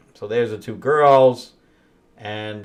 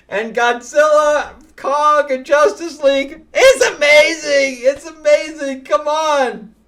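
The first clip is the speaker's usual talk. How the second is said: much higher, loud, near-shouting delight; real excited gushing; 7 dB louder than usual. Real, not acted. Reviewer raves to viewers about Godzilla versus Kong, shouting with excitement. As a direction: shout your love for the comic to the viewers.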